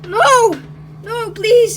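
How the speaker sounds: loud, high cries; desperate pleading. Acted. Mario pleading with departing friends, desperate cries. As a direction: beg them not to leave. Begging not to be left.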